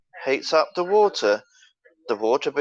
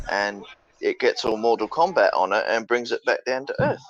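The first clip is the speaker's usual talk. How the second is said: ordinary talk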